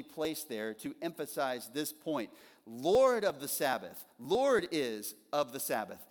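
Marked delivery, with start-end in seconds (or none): 2.6-6.0 "Lord" hit loud and slow at the front of the phrase; stress on Lord. Shown as a demonstration. Preacher showing the congregation the emphatic word order, stressing "Lord". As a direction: lean hard on "Lord" each time and slow right down. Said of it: "The very first word of the phrase is the word Lord".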